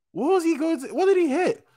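squeaky-high puzzled questioning; high-pitched bafflement. Real, not acted. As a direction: voice shoots way up in confusion. Baffled by the manga panel.

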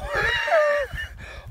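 a shrieking, very high laugh; burst of laughter. Real, not acted. Laughing at his mate's odd find in a high shriek. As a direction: crack up at the surprise.

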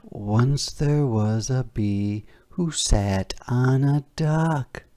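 plain reading voice

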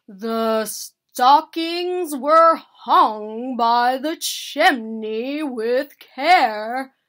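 very loud, slow declaiming; loud recitation. A narrator's reading. Bear pressing the hint on sad Mouse, reciting loudly. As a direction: boom the verse out slowly, word by word. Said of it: "Bear repeated more loudly".

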